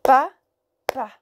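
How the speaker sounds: "Pa, pa" détaché, une pause entre les deux syllabes; papa en syllabes. Shown as a demonstration. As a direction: frappe chaque syllabe séparément. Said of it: "Deux syllabes". (papa syllabe par syllabe)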